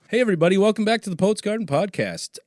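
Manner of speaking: high, loud show opener; podcast intro greeting. Real, not acted. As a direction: launch the show with big host energy. Host restarts the podcast intro.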